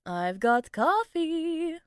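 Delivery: high, slow sing-song tease. Acted. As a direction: sing it out to lure her. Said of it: "Priya added in a sing-song voice".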